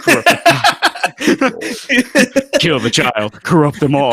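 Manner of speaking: loud mock villain snarl through laughter; hammed-up demon voice. Friends joking, voicing the demon maids' hammed-up evil plan. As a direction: play the demon maids plotting, cackling.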